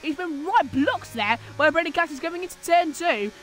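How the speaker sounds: hyped-up play-by-play; excited commentary. Acted. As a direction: call the move as it happens. Live race call.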